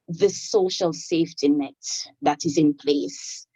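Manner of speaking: ordinary talk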